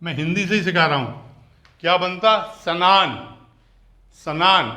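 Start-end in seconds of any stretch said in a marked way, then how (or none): 2.6-4.7 firm 'sa-naan' for स्नान; full 'sa' sound. Shown as a demonstration. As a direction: say स्नान wrongly, with an a after the s. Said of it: "अगर सा की आवाज़ आती".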